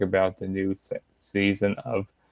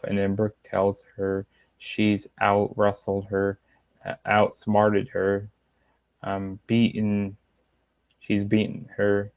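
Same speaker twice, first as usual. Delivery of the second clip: usual speaking voice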